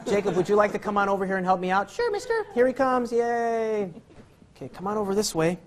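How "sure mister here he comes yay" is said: very high, childlike put-on voice; mock eager volunteer. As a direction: squeak it like an over-eager little helper. Answers for the volunteer being called up.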